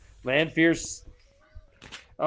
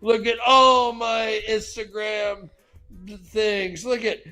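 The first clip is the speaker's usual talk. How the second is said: eager show-off; 7 semitones higher than usual. Acted. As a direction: push it in their face, pitched up. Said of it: "He's like".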